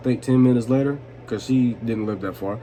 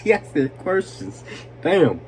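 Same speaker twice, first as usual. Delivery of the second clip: slow, higher, through real laughter; laughing; 4 semitones higher, slower than usual. Real, not acted. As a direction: barely get it out while cracking up. Laughing at own pronunciation.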